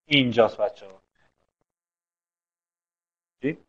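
ordinary talk, nothing marked

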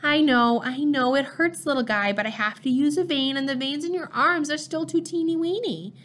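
put-on father soothing the newborn in high baby talk; special baby voice. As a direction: comfort the newborn in a cooing voice. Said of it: "Still in the special voice".

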